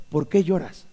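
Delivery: soft, tender asking; gentle question. Shown as a demonstration. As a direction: ask it quietly like Jesus would. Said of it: "La pregunta es perfecta".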